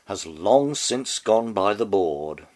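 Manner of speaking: normal talking voice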